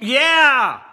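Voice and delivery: loud, high cheer; excited shout. Real, not acted. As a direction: whoop it out. Cheering at the end.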